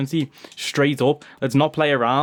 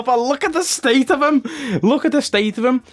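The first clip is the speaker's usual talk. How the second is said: higher, giggling through it; laughing; 8 semitones higher than usual. Real, not acted. Streamer laughing at the new signing, giggly. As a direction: laugh in amazement.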